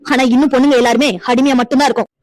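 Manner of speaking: usual speaking voice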